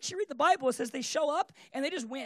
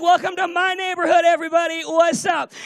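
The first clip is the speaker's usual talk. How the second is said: loud, higher triumphant announcement; swaggering boast; 4 semitones higher, 12 dB louder than usual. Retold or imitated. Kid walking outside after dad's threat, swaggering boast. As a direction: crow it to the whole street. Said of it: "how do you think I walked outside".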